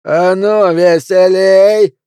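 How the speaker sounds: loud high drawn-out holler; raucous shout, last word stretched; shouting at musicians. A narrator's reading. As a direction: holler across the room to the band. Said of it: "крикнул он музыкантам".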